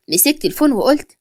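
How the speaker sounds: ordinary delivery, nothing marked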